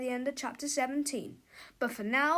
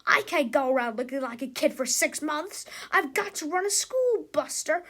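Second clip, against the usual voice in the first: loud, high bossy tirade; angry rant; 4 semitones higher, 5 dB louder than usual. A narrator's reading. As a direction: scold like an irate principal. Cruppy ranting on.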